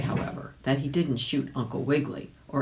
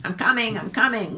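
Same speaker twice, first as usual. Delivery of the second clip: a touch higher dog voice; eager hound answering; second dog; 7 semitones higher, 4 dB louder, faster than usual. A narrator's reading. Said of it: "barked the second dog".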